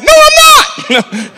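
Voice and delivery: put-on high, slow shouted child refusal; little boy yelling no at the choir director; defiant little boy. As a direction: shout it like a stubborn kid. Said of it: "And I said".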